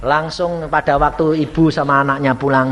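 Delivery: ordinary talk, nothing marked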